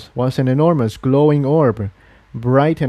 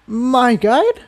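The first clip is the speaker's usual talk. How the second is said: much higher, unsure echo; confused young mare; 11 semitones higher than usual. Acted. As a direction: back away with a question. Tiptoe, confused.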